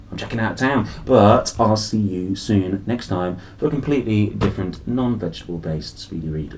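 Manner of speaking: usual speaking voice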